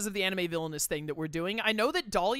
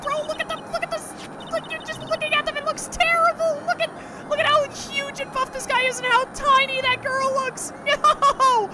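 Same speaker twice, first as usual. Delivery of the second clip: appalled shrieking; 9 dB louder than usual. Real, not acted. Appalled at game scene.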